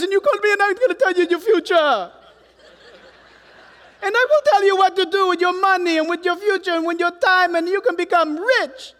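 badly faked Jamaican accent, high and loud; hammed-up Jamaican fortune-teller, belting out promises; Cleo. Preacher mimicking a TV psychic for the congregation, mock Jamaican accent. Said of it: "I can't do accents, but she would talk like this".

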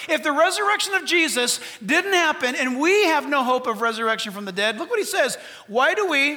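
loud, driving sermon voice; full-force preaching. Acted. Preacher pressing the church, loud and driving. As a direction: preach it hard to the congregation.

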